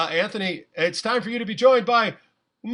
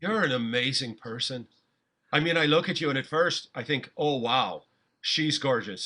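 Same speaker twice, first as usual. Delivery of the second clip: tender; 8 semitones lower, 5 dB quieter than usual. Acted. Improv date scene, smitten suitor.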